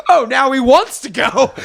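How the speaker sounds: high, laughing jeer; mocking laughter. Bar guys laughing and mocking the drunk kid, high and amused. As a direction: laugh at him while mocking. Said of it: "they're like".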